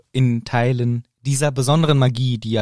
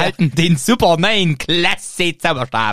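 loud, pushy TV-shop pitch; chipper 1950s ad; 4 semitones higher, 4 dB louder than usual. Retold or imitated. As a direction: sell it like a cheesy commercial. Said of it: "es wäre eine P-R-Aktion gewesen". (aufdringliche Werbestimme)

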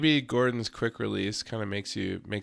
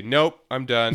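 louder, abrupt announcement; mock toddler refusal; 3 semitones higher, 7 dB louder, slower than usual. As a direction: imitate a kid who has decided to quit. Imitates daughter quitting the potty.

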